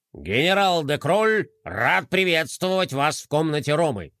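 a hint of a formal rabbit general; lightly pompous general. Rabbit general welcoming the new toys, mildly pompous. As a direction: welcome them with mild pomp.

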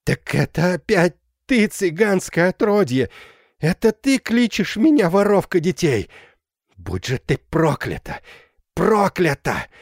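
high, loud rasping curse, slowing; death groan. A narrator's reading. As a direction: curse in a dying rattle, rising. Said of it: "голосом, походившим на предсмертное хрипение".

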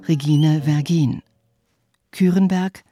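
normal talking voice

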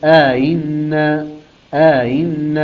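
آئن with an inserted alif and eased hamza; Qalun reading. Shown as a demonstration. Teacher demonstrating Qalun's reading, drawn out. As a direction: stretch the alif in آئن, soften the second hamza. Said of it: "أيضًا يدخل ألف".

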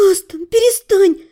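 high, imploring protest; pleading. A narrator's reading. Heroine begs the kissing count to stop, high plea. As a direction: beg them to stop, pitch lifted. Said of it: "взмолилась я".

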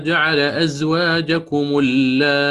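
slow chanted recitation, ya held long; تلاوة مع إشباع المد; Quranic recitation with full madd. Shown as a demonstration. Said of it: "فيقرأ هكذا".

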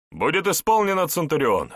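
high, loud keen reply; eager soldier's reply. Acted. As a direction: snap to the order. Soldier eagerly confirms order.

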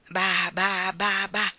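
put-on babbling baby voice, syllables stretched; baby babble. A narrator's reading. Baby Barbara eating her biscuit, babbling. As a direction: prattle like a contented baby. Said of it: "ciangottando dolcemente".

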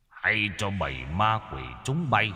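soft, distant, rising sharply at the end; a stern old master's challenge carried on the wind; old exorcist rebuking the ghosts from far away; steely voice from afar. Acted. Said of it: "giọng nói đanh thép của ông cụ Lân".

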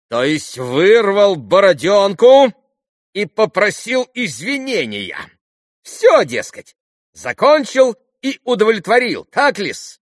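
very high, loud, drawn-out sarcasm; high-pitched indignant buffoon; bitterly mocking captain. A narrator's reading. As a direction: jeer in a high, agitated voice.

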